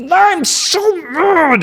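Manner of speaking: high, slow whiny mock voice; mock tantrum. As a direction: play God as a sulking child. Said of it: "He's like".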